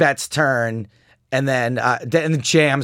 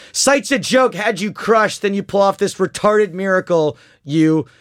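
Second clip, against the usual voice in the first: mock rage; 6 semitones higher, 3 dB louder than usual. Reading out a raging opponent's chat.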